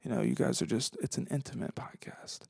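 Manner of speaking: soft unvoiced whisper. Real, not acted. Podcaster leaning in to listeners, whispering. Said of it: "I'm gonna start talking like this".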